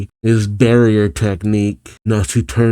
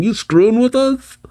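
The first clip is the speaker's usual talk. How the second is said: mock outrage; 13 semitones higher than usual. Acted outrage while punching Cancer.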